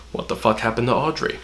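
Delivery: ordinary talk, nothing marked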